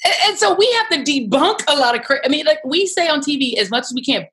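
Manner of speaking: ordinary talk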